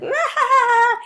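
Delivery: sudden high maniacal laughing shriek. Retold or imitated. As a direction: burst into a loud, high cackle like a young Joker. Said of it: "he just starts laughing maniacally".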